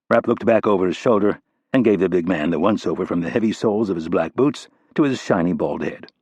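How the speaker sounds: usual speaking voice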